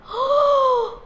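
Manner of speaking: loud, high gasp of outrage; sharp indignant gasp. Acted. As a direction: suck in air as fury hits. Acts out anger surging up. (기가 막혀 헉 들이마시는 숨)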